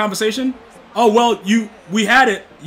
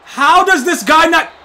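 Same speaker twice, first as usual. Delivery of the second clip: higher, louder, slow; frustrated yell; 8 semitones higher, 7 dB louder, slower than usual. Real, not acted. Gamer yells at the screen, higher, louder and slow.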